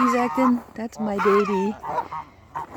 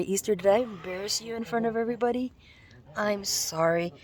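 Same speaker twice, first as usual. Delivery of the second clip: normal talking voice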